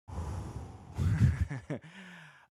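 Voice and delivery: long, loud blown-out breath; heavy exhale. Real, not acted. Storyteller recalling when everyone came in, heavy sigh. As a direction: let out a big breath before going on.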